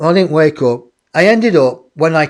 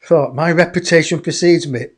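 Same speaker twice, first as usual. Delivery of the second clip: ordinary talk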